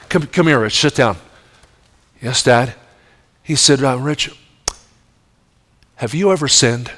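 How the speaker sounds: a dad gently asking his son about sin at bedtime; gentle fatherly. Retold or imitated. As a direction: draw the boy in gently.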